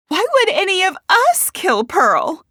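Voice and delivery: high, loud disbelief; indignant challenge. A narrator's reading. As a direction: challenge the accusation at full voice. Quarrel over who killed.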